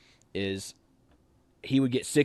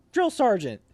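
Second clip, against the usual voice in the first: calling out; 9 semitones higher, 5 dB louder than usual. Retold or imitated. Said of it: "So I just go".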